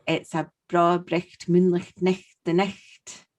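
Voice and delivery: slow Scots with throaty "ch" in "bricht moonlicht nicht"; Scottish dialect phrase. Shown as a demonstration. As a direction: show off a cheesy Scottish saying, guttural and slow. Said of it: "well-known but cheesy Scottish phrase".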